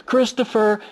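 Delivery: loud, high call across a playground; searching parent calling the boy's name loudly. Retold or imitated. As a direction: call out for a lost child.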